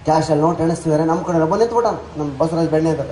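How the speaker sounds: ordinary delivery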